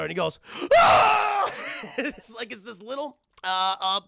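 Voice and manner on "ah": long, high, loud scream; startled scream. Retold or imitated. Man facing a talking candlestick, screaming. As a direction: scream at full pitch in mock terror.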